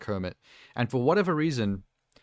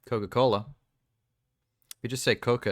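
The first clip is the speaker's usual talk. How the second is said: ordinary delivery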